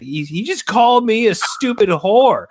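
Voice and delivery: high, loud indignant tattle; whiny tattling brother. Retold or imitated. Brother tattling to mom, high indignant whine.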